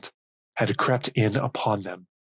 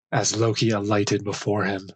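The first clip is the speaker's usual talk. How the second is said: ordinary delivery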